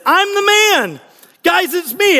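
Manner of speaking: high-pitched, insistent plea to be recognized. Retold or imitated. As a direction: insist on who you are, voice lifted. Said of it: "he himself insisted".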